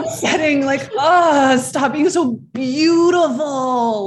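high, loud mock despair through laughter; mock exasperation. Teasing attractive castmates with mock upset. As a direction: groan playfully as if beauty were an outrage. Playful complaint about friends' good looks.

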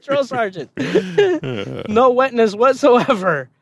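high, eager yes-sir reply between laughs; overeager compliance. Retold or imitated. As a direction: play the overeager recruit, grinning. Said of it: "what I really said is".

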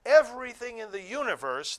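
exultant loud reading of scripture; crying out in praise. Acted. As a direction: call it out as if all creation sings. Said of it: "was crying out".

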